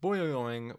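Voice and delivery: loud bouncy boing sounds; boing like a cartoon spring. Repeats clown sound effect.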